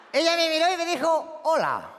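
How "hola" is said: very low hello at full level; deep, comic echo of a galán; mock deep reply. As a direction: answer in the same deep seducer's tone. Woman answers in matching deep voice.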